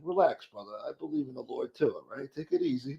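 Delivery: quiet reassuring reply; calming himself down. Retold or imitated. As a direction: talk him down gently. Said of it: "I'm like".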